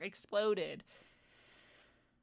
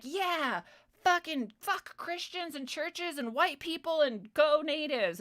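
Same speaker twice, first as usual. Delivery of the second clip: higher, hammed-up outraged chant; mocking angry mob voice; 7 semitones higher, slower than usual. Mocking followers chanting against Christians, hammed-up outrage. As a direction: sneer it like a frenzied crowd. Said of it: "be like".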